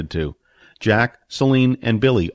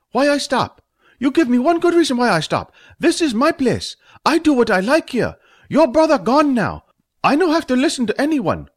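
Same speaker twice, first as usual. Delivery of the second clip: put-on much higher, louder ranting accented voice; Fat's tirade; 4 dB louder than usual. Owner defying Stump in his own place, high ranting voice. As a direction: rant at him in a high, defiant voice. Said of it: "Fat's tirade".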